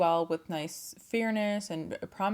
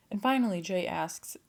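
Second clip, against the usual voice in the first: ordinary delivery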